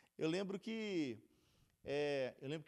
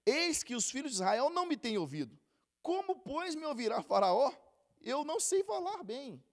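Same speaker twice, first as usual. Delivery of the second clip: scripture reading; 6 semitones higher, 6 dB louder than usual. Acted. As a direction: proclaim the verse to the congregation. Reads scripture aloud. (leitura bíblica proclamada)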